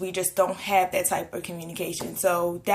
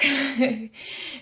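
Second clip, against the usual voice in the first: short laugh; 5 semitones higher than usual. Real, not acted. Laughs after her retort.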